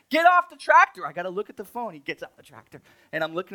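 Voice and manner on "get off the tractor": much higher and louder yell; shout at kid. Retold or imitated. Father yelling at his son on the tractor, loud shout. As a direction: bark it across the garden center. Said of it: "And I'm like".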